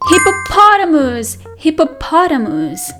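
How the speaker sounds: usual speaking voice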